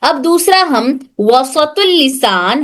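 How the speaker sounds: usual speaking voice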